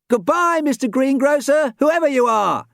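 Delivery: very high, loud grand farewell; dramatic proclamation. Acted. Dan calling to the sky, loud dramatic farewell. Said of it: "he proclaimed dramatically".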